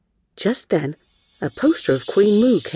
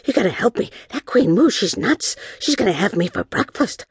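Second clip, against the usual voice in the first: pleading talking dog, pitched up; Buddy; 6 semitones higher than usual. Acted. Dog begging the girls to save him, pleading. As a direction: beg for help as a worried hound.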